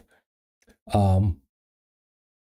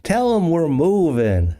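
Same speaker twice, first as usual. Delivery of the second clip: higher, slow called-out line; a mild mock call from the background; Betty calling from background; 8 semitones higher than usual. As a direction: call it out from across the room. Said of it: "I hear Betty in the background".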